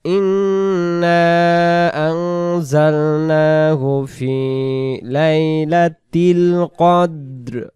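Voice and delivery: slow, melodic Quran recitation. Acted. Teacher recites Surah Qadr for the students, melodic. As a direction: recite each phrase slowly in tajweed.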